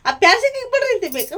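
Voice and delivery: high, loud and fast retort; mock yell of a daughter scolding her mother; imitating a shout. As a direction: snap it back like a scolding daughter. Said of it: "वो चिल्ला देती है मेरे को".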